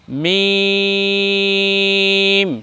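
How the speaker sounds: মিম with a very long held vowel; lips-together articulation. Shown as a demonstration. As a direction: draw মিম out on one level note. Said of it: "দুই ঠোঁটের শুকনা স্থানে লাগিয়ে".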